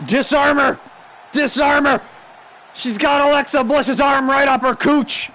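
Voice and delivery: high, loud repeated yell; shouted move call. Real, not acted. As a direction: bellow the move name twice like a wrestling announcer. Calling the finishing move.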